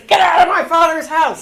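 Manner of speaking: high, loud furious shout; Jesus shouting at temple sellers; angry shout. Retold or imitated. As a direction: drive them out with a roar. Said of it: "and he goes".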